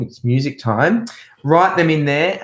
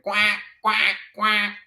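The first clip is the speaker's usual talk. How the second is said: higher, softer quacking; higher soft duck quacks; 7 semitones higher, 5 dB quieter than usual. Pretend. Duck sound in children's song.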